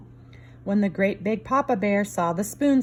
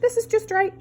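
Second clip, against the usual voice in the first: Goldilocks voice; 12 semitones higher than usual. Acted. Goldilocks in chair.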